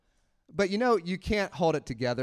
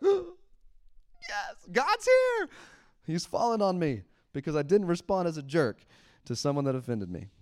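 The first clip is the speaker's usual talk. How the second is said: very high, ecstatic fake revelation; mock rapture; 4 semitones higher than usual. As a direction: gush like you've just been touched by God. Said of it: "we're like".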